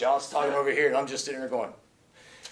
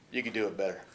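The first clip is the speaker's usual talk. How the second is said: low, soft retold criticism; understated note; 9 semitones lower, 8 dB quieter, faster than usual. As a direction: imitate the son dropping a quiet note. Retold son giving a note.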